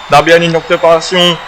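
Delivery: rising hype call; excited shout. Real, not acted. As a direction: shout the move as it comes. Commentator hyping the move.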